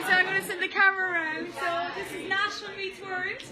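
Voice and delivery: ordinary talk, nothing marked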